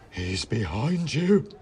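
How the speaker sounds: slow, drawn-out horrified warning; aghast, slow warning; gasping alarm. A narrator's reading. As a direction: stretch it out in dread. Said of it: "Then Whittington gasped".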